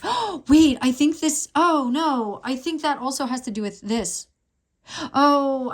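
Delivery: oh wait, a surprised gasp; sudden gasp of realization. Real, not acted. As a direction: catch your breath as it clicks. Sudden realization about card.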